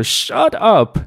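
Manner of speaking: slow Shut up, punch the closing P; hard P burst on Shut up; heavy final P. Shown as a demonstration. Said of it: "他把那个P读得很重啊".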